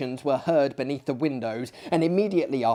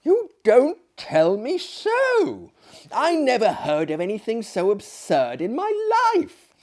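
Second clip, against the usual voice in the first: astonished disbelief; 9 semitones higher, 4 dB louder than usual. Acted. Narrator astonished at the tale.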